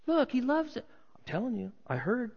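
high-pitched gossipy insistence; insistent onlooker. Acted. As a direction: argue it in a high voice. Onlookers argue over the rumor.

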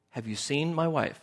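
normal talking voice

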